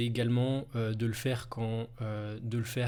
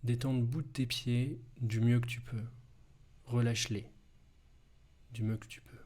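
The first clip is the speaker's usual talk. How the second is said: guided hypnosis. Acted. Hypnosis body relaxation.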